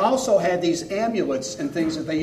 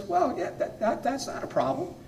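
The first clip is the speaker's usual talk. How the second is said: much higher, hesitant bear voice; agreeable bear; 11 semitones higher, 4 dB quieter than usual. Acted. Bear answers hunter's wish for a fur coat, high hesitant agreeing. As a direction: stumble lightly into agreeing, pitched well up. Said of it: "the bear thought for a minute".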